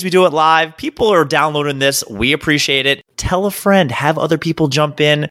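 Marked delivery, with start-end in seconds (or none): none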